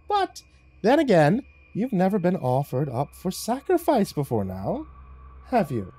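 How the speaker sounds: high, lilting, sinister tease; taunting devil cat. Acted. Lucifer breaking news of sacrifice, high and teasing. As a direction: dangle the threat playfully, pitched high.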